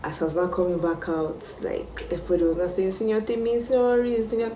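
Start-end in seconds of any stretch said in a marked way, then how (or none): 2.9-4.5 high, pleading chorus of apology; pleading schoolmates. Retold or imitated. Schoolmates begging their senior for forgiveness, higher pleading voice. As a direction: beg in a higher, small voice.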